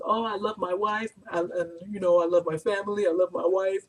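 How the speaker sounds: mother mocking the man's excuses, lightly voiced; imitating the nervous man. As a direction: mimic his flustered excuses.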